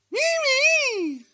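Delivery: very high squawking parrot; high bird cry. Acted.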